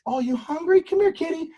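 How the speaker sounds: high, soft coaxing call; coaxing kitty voice. Retold or imitated. Coaxing the hungry stray closer, soft high voice.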